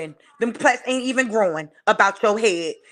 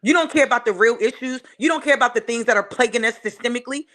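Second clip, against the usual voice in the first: normal talking voice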